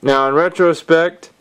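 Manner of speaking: ordinary talk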